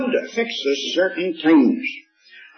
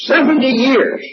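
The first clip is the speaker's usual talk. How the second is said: "Seventy years" pitched high and loud, "Seventy" hit hardest; stressed seventy years; 9 semitones higher, 4 dB louder than usual. Real, not acted. Preacher to congregation, stressing the number with a high, loud lift. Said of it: "I'm emphasizing the word years".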